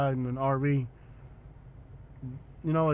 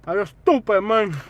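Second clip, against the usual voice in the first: high, hammed-up yell; loud catchphrase shout; 8 semitones higher, 12 dB louder than usual. Vlogger blurting a catchphrase between questions, hammed-up shout. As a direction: shout it like a much louder, higher catchphrase.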